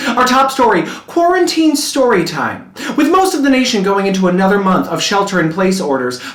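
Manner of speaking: normal talking voice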